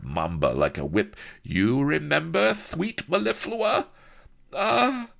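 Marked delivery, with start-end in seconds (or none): 1.4-3.9 menacing viceroy. Acted. Viceroy menacing his consort. 4.5-5.1 very high, slow faltering apology; falter, bewildered and timid; very high, slow frightened falter. Acted. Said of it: "faltered the bewildered Hidalga".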